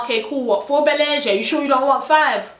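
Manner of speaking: bright, overeager sarcastic offer; sarcastic, over-helpful offer to a cheeky buyer; mock eager. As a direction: play along like a too-keen seller. Mock-eager reply to pushy guy.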